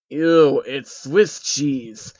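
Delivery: loud ew then slow drawn-out whine; a spoiled old man complaining; whining. Acted. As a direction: Soros whining about the cheese in his sandwich. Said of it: "he whined".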